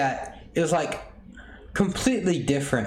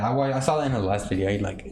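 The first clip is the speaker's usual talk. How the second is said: ordinary delivery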